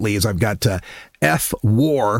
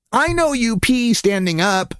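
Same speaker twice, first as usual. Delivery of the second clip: slow, high child imitation; little girl; 9 semitones higher than usual. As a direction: announce it like a blunt small kid. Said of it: "she says".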